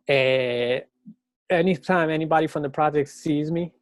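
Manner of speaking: ordinary delivery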